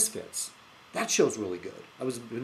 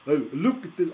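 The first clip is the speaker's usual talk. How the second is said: put-on half-hearted Scottish accent; attempted Scottish accent; 3 semitones higher, 4 dB louder than usual. Vlogger admitting he can't do it, faltering Scottish accent attempt. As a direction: try a Scottish accent and give up. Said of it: "I can't do a Scottish accent".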